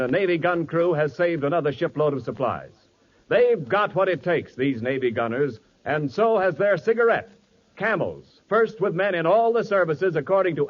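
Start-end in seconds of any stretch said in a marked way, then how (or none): none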